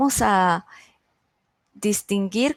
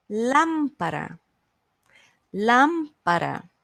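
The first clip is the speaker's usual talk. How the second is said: slow "Lámpara", first syllable stretched; stress on LÁM; 2 semitones higher, slower than usual. Shown as a demonstration. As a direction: punch LÁM in "Lámpara", let the rest fall. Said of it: "Antepenúltima".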